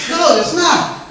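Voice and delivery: slow, high, mock-serious denial; drawn-out sarcastic no; deadpan mock agreement. Real, not acted. Sarcastic reply.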